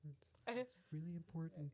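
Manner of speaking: soft, hushed pretend parent; mock whisper. Parent telling child something important, pretend whisper. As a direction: play a parent talking too quietly to catch.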